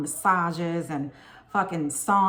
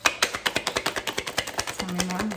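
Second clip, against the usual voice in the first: whispered ask; 2 semitones lower, much slower than usual. Real, not acted. Asking the tarot cards for more.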